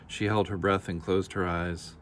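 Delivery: ordinary delivery, nothing marked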